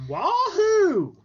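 high, loud cartoon wahoo; wahoo yell. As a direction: shout it like Mario. Mario catchphrase yell.

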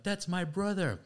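mock alarmed shout; calling out. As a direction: call out to stop them.

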